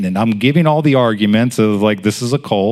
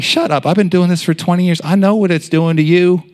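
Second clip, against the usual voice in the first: higher, insistent comeback; defiant retort; 9 semitones higher than usual. Retold or imitated. Preacher telling the enemy to shut up, defiant. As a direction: push back hard, pitch climbing.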